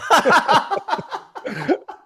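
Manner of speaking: real high burst of laughter. Real, not acted. Joking answer to role-play.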